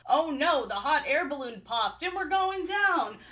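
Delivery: hammed-up disaster cry, rising to a scream; playful fake alarm ending high; mock panic. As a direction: act out a crash, pitch climbing. Acts out balloon crash mockingly.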